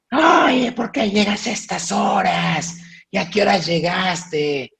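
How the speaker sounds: high-pitched mock mother scolding. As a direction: wail at the late-coming daughter. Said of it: "Y dicen".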